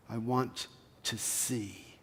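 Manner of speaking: soft, slow and small; simple quiet plea. Acted. Beggar answering Jesus, a soft humble plea. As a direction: ask it humbly, barely above a breath. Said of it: "your response is simply".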